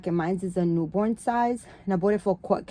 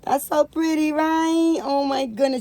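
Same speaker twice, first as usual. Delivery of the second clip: excited gush; 8 semitones higher, 5 dB louder than usual. Real, not acted. Admiring the dress.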